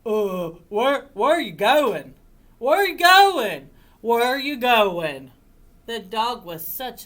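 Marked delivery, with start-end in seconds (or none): 0.0-5.3 loud, eager dog voice; insistent, loud doggy questioning; excited dog. Acted. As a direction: pester them like a bounding dog. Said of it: "running up but the dog".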